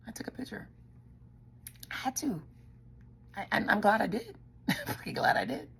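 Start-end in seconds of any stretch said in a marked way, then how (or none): 0.1-2.4 hushed confiding whisper. Real, not acted. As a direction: lean in and whisper it. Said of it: "whispered repeat for emphasis".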